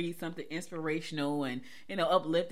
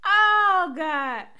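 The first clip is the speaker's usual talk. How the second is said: loud, high cry after laughing; amused, stretched-out high wail; laughed-out exclamation; 10 semitones higher, 11 dB louder than usual. Real, not acted. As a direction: burst out high and loud, then come down. Catching herself after a laughing fit.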